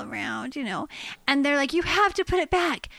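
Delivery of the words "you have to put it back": high-pitched imitation of insistent children; pleading kids. Kids begging mom about the baby mouse, imitated pleading. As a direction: beg like kids who won't give up.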